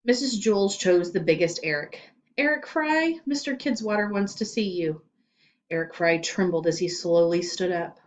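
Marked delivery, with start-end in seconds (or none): 2.4-5.0 calling out. Acted. Teacher calling student to office.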